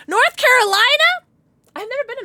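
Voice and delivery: surprised. Real, not acted. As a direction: sound delighted and amazed. Reacting to a viewer's location.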